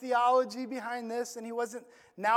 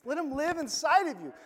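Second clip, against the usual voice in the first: usual speaking voice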